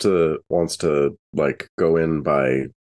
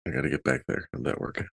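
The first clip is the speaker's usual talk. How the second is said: quiet aside; 5 semitones lower, 10 dB quieter, much faster than usual. Real, not acted. Muttering aside off mic.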